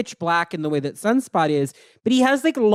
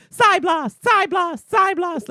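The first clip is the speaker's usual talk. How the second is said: video game attack shouts; 13 semitones higher, slower than usual. Retold or imitated. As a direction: shout the move name like a fighting game character. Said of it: "Psylocke saying".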